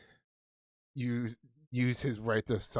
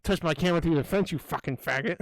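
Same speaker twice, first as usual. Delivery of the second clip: mock taunt; 6 semitones higher than usual. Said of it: "he's like".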